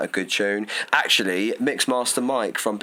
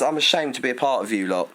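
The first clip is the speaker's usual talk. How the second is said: ordinary talk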